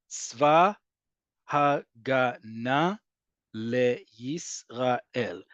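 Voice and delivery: "Tzva Hagana Yisrael" stretched out, every syllable separated; drawn out, syllable by syllable. Shown as a demonstration. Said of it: "That's eight syllables".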